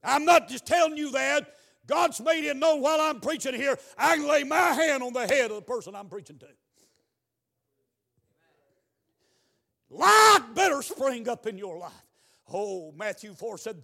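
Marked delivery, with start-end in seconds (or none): 0.0-11.9 loud preacher's warning, punching the key words; forceful preaching. Real, not acted. Preacher warning the person he's preaching to, forceful preaching. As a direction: press it on them loudly.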